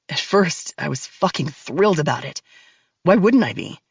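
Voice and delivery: low, cold male villain voice; deep and cold, taunting her; menacing man. Acted. Max's voice in story.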